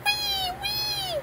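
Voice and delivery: very high squealed mock sob; tiny fake crying wail; pretend lion crying. Playing little lion after "go", high pretend wail. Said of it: "Lion go".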